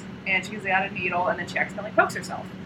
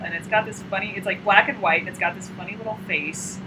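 ordinary delivery

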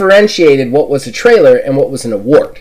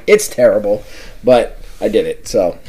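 normal talking voice

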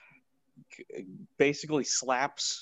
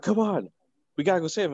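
raised-pitch, pleading imitation; urging Pepper; 5 semitones higher, much faster than usual. Pepper urges Rhodey to save him, mock urging. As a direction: urge them along, pitched up.

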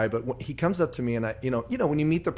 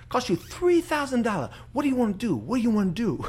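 a priest's joke told in an imitated Filipino accent, rising higher; 6 semitones higher than usual. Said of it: "pretty good impression you do there".

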